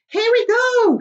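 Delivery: high, loud, jubilant; excited shout. Acted. Old grasshopper shouting to everyone, hopping with excitement. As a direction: holler it with glee. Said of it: "shouted the old green grasshopper".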